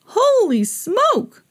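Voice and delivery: very high, very slow astonished exclaim; gasped. A narrator's reading. Beach attendant spotting Harry, gasping. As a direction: gasp it out in shock. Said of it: "one of them gasped".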